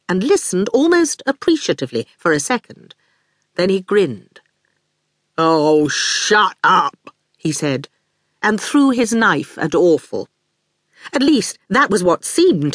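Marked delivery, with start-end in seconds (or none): 5.4-7.2 slow, daft lout; strong daft voice. A narrator's reading. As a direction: speak slowly and strongly, like a big dim thug. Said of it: "He spoke in a strong, daft voice".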